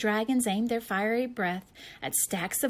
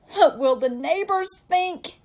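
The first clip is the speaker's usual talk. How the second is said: worried mother; 9 semitones higher, 8 dB louder than usual. Acted. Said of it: "worried his mother".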